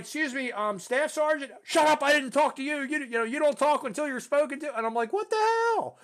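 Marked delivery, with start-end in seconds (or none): 0.0-1.5 high-pitched, tentative address; retold interruption. Graduate trying to speak up to staff sergeant, high hesitant appeal. 1.6-4.8 loud, high sergeant rebuke; retold screaming. Staff sergeant to recruit who spoke up, mock screaming. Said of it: "Screaming at everybody in the van". 5.1-6.0 very high, loud disbelief; retold shock. Graduate reacting to sergeant's rebuke, high-pitched shocked outburst.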